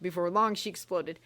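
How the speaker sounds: ordinary delivery, nothing marked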